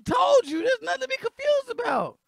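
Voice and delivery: loud, high, incredulous yelling; outraged shouting. Real, not acted. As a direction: yell at her in disbelief. Reactor yells at woman in clip.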